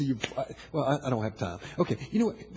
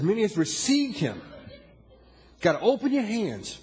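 booming motivational speech; 4 semitones higher than usual. Acted. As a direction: push the words out to the hall. Preaching at full force.

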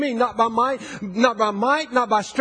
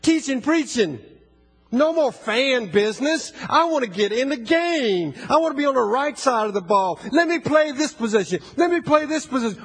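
preaching at full force; 3 semitones higher than usual. Acted. Preacher urging his church to get in the game, full-force preaching. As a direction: carry the congregation with you.

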